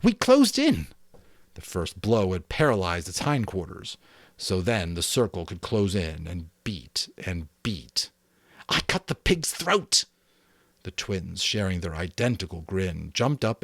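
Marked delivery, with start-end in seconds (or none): 0.0-0.9 very high and loud; excited boys. A narrator's reading. The boys boasting of the kill, very high and loud. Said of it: "relieved and excited". 8.7-10.1 high, loud and proud; Jack's boast. A narrator's reading. Jack bragging to the boys, high and proud.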